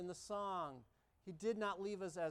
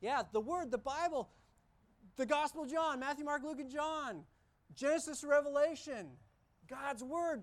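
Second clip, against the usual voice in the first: raised, fervent sermon delivery; preaching at full force; 6 semitones higher, 5 dB louder than usual. Acted. Pastor rousing the congregation about God's Word, fervent preaching. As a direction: build the list with conviction.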